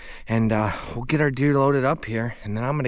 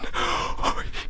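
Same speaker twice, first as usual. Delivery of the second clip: whispered gasp. Real, not acted. Hunter just shot a buck.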